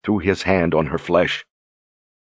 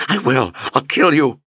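rising desperate threat; 8 semitones higher than usual. A narrator's reading.

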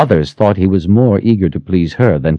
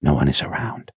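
hushed; 3 semitones lower, 8 dB quieter than usual. A narrator's reading. Lover reassuring, hushed.

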